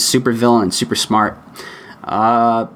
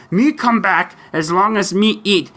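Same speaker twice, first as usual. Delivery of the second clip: high monster puppet voice; Cookie Monster; 8 semitones higher than usual. Pretend. Host attempts an answer about posting more, Cookie Monster impersonation. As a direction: put on a squawky puppet monster. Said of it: "do my best Cookie Monster imp-, impersonation".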